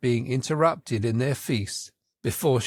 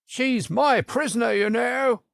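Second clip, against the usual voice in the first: much higher knight voice; the Red Knight; 10 semitones higher than usual. A narrator's reading. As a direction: stake the claim, pitched high. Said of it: "the Red Knight said at last".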